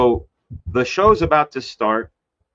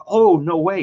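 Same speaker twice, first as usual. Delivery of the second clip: high, louder "no way"; surprised; 7 semitones higher, 4 dB louder than usual. Retold or imitated. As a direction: gasp it out in disbelief. Retold surprised reply.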